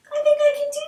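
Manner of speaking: unvoiced whisper to yourself. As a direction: breathe it like a secret. Student self-talk, whispered.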